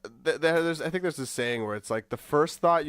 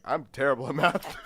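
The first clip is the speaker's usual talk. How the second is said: ordinary talk, nothing marked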